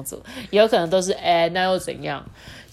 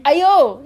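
high, loud playful ouch; ouch cry; 8 semitones higher, 10 dB louder than usual. Acted. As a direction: yelp like a pricked hedgehog. Acting the hedgehog's cry.

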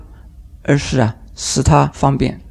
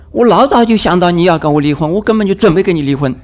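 higher, louder, fast retort in a quarrel; spouse firing back; 5 semitones higher, 4 dB louder, faster than usual. Acted. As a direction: rattle it off like a fed-up spouse mid-fight. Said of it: "另外一边说". (吵架时又快又冲地顶回去)

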